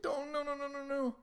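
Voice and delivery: high-pitched string of frantic refusals; imitated panicked daughter. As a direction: plead like a scared kid, hammed up. Voicing daughter still pleading in panic.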